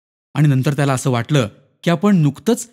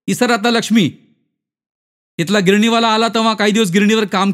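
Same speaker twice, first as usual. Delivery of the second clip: raised-pitch, scornful telling-off; higher, taunting character voice; scornful acquaintance; 7 semitones higher, 3 dB louder than usual. Acted. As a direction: break the news with a jeer, pitched up.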